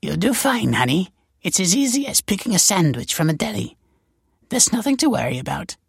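high, soothing voice; smooth over the nerves in a high-pitched lilt; reassuring. A narrator's reading. Val patching up a nervous girl's cracks, reassuring. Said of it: "came over to patch up the cracks".

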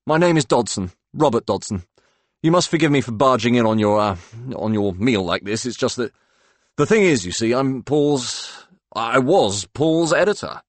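halting, lightly voiced English gentleman; apologetic English editor. Robert introducing himself to Claude, halting apologetic Englishman. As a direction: fumble politely through an awkward introduction.

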